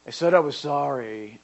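put-on sulky kid protesting; grudging insistence. Kid answering a doubting parent, sulky and insistent. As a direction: insist sulkily that you already apologized.

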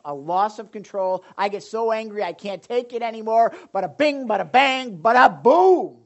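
imitating an angry parent. Preacher acting out a parent losing control, hammed-up rage. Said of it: "Where it's like".

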